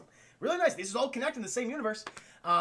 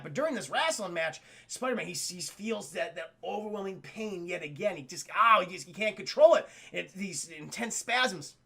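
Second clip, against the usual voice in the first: ordinary talk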